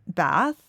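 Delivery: "Bath" with short A, lifted as a question. Shown as a demonstration. Testing the town's name aloud, "Bath" with short A. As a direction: try out "Bath" rhyming with "math", rising. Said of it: "if you're from Bath".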